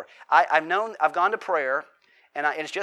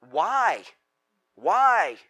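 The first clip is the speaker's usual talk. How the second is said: a louder, strained why; anguished cry; 4 semitones higher, 7 dB louder than usual. Retold or imitated. Psalmist crying out to God, louder anguished why. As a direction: cry it out to heaven. Preacher voicing the anguished question.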